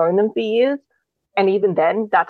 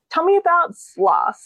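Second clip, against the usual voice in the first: casual, higher-pitched sample question; subtly probing; 11 semitones higher than usual. Acted. Showing how to check someone's story, subtly probing. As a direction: ask lightly, as if in passing. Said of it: "ask them really subtly".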